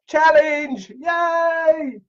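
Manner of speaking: very high squeal of joy; cheering. Real, not acted. [name] to live viewers about making covers, gleeful cheer.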